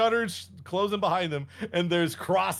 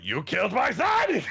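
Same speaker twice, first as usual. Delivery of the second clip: Dracula's mock yell; 4 semitones higher than usual. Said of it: "Dracula's like".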